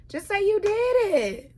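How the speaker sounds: high, loud shouting rant; heated rant. Real, not acted. Host shouting at Lisa to admit the truth. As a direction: yell at her to come clean.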